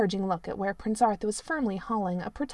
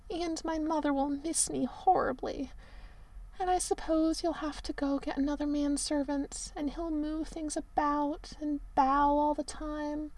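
hammed-up tragic Merlin; 6 semitones higher, 4 dB quieter than usual. A narrator's reading. Merlin moans to the prince about his cold room.

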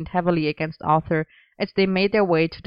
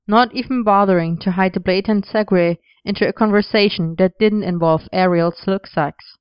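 usual speaking voice

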